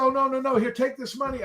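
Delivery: high-pitched imitation of a woman; mock insistent lady; pleading lady customer. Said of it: "And she said".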